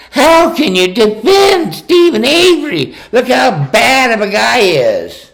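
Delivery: high mocking falsetto as a sneering woman; high, sneering put-on woman's voice. Ranting at the documentary makers, sneering high mock woman's voice. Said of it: "Which is".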